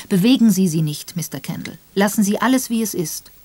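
put-on old lady taking charge of the scene; clear and authoritative. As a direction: stop him with calm authority. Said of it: "Deutlich und mit Autorität sagte sie".

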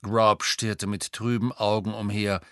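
plain reading voice

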